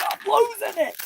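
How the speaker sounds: very high thin "I'm losing it."; mock dramatic squeal; squeaky panic. Real, not acted. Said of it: "I'm almost losing it".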